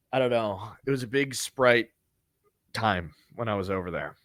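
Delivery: ordinary talk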